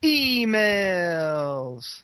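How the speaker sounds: long drawn-out call, high and sliding down; segment call-out. Pretend. Host opening the email segment, drawn-out call-out. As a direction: stretch it out as a mock-grand announcement.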